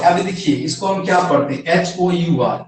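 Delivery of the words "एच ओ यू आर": ordinary delivery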